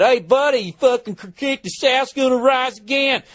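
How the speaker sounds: high, hammed-up Southern yokel, slowing at the end. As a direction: play a rowdy Confederate heckler. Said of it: "If some guy said".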